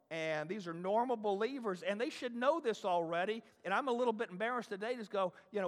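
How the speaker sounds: ordinary delivery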